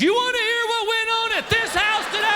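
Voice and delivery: mock wife scream. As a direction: burst out like a fed-up wife. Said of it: "Your wife screams".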